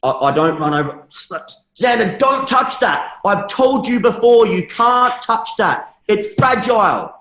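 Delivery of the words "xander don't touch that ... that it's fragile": loud, high, slow scolding; stern parental yell. Retold or imitated. Dad scolding his toddler for touching fragile glasses, loud and slow. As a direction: bark it out like a cross dad.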